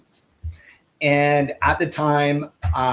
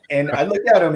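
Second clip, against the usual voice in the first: normal talking voice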